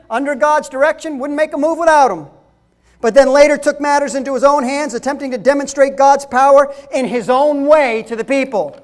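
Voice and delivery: loud, high fiery preaching; preaching at full force. Acted. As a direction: preach it hard to the congregation. Preaching loud about Moses.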